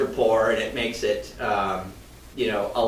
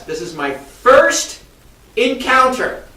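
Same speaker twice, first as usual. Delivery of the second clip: slow, with FIRST and encounter pushed higher and louder; emphatic build-up; 11 semitones higher, 6 dB louder, much slower than usual. Real, not acted. Telling viewers about his first deal, slow and emphatic. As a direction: lean hard on "first", then lift "encounter" way up.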